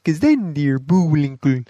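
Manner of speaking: goofy cartoon moose impression; put-on cartoon moose, pitch swooping up; Bullwinkle. Shown as a demonstration. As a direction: sneak into a silly moose voice. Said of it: "like, sneak into it".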